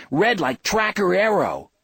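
put-on high, loud kid voice; little boy chipping in. A narrator's reading. Small boy butting into the grown-ups' talk, childish chiming in. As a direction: pipe up like an eager small child. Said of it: "Ralphie chipped in".

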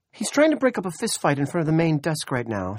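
high, loud, stressed woman; rushed, overwhelmed desk officer; harried dispatcher. A narrator's reading. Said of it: "she said".

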